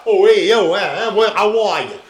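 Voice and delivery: loud, brash New York mob boss voice; mafia mob boss accent. Shown as a demonstration. Showing the mob boss voice he grew up hearing, loud New York mob accent. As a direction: bark it out like a mafia boss from the old neighborhood. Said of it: "my normal speech pattern is really not".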